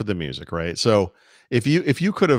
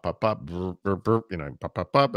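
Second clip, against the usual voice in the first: scanner noises; 4 dB quieter than usual. Shown as a demonstration. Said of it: "I sit here and pop".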